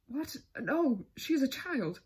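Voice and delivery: slow, loud, indignant disbelief; stunned and outraged; shocked. A narrator's reading. Count shocked by question.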